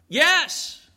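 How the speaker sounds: high, distant-sounding answer; calling back. Acted. Drunk stranger answers from the rain, calling back. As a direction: holler back high from afar.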